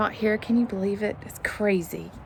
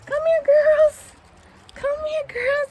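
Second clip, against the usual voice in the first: very high calling voice; calling the hens; 6 dB louder, slower than usual. Real, not acted. Calling her hens over.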